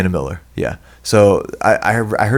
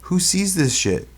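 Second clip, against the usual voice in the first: scoffing disbelief; 9 semitones higher, 3 dB quieter, slower than usual. Real, not acted. Scoffing at film's success.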